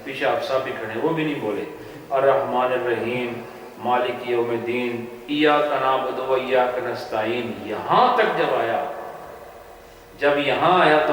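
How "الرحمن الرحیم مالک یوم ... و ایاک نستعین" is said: slow, measured Quranic recitation; slow recited Arabic, drawn out; retold prayer recitation. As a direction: recite it slowly as if leading prayer. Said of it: "قرات شروع کر دی".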